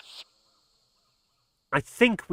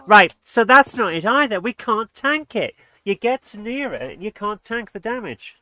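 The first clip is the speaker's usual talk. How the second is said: louder, higher, fed-up rant; frustrated outburst; 5 semitones higher, 8 dB louder than usual. Real, not acted. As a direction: vent at the game. Frustrated gamer stuck in level.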